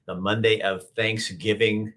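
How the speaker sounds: ordinary talk, nothing marked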